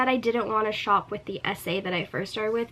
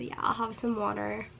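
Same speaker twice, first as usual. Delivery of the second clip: softer, lower, polite request; polite and quiet; 3 semitones lower, 7 dB quieter than usual. Retold or imitated. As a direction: ask politely, toned down. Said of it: "I didn't say it like that".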